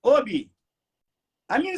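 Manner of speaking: usual speaking voice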